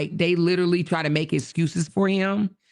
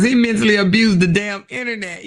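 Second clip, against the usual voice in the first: loud emphatic; 4 semitones higher, 7 dB louder than usual. Real, not acted. Loud first take.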